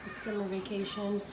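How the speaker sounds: whispering quietly to the camera. Real, not acted. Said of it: "I have to whisper because".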